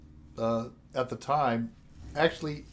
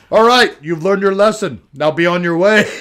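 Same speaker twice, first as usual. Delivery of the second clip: loud mock cop; 4 semitones higher, 12 dB louder than usual. Acting Canadian cop scolding.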